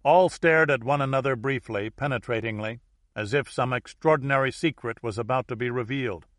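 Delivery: ordinary delivery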